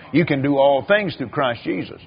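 ordinary talk

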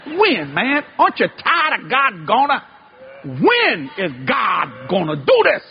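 rising, pressing shout of when, slowing at the end; shouted challenge. Acted. Preacher shouts at the convention crowd, demanding when. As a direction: demand an answer from the congregation.

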